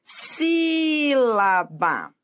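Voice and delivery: Sílaba very slow, SÍ held long; stress on antepenultimate syllable. Shown as a demonstration. Teacher showing a proparoxítona, long SÍ in slow Sílaba. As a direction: stretch SÍ, then place LA and BA in Sílaba. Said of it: "A sílaba tônica é a antepenúltima sílaba".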